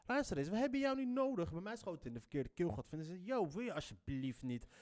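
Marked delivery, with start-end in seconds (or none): 0.1-1.5 higher, punchy mock comeback; raised, defiant yo-luister put-down; indignant retort. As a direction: snap back at him like a fed-up kid from the block. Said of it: "Vooral in de eerste plaats van".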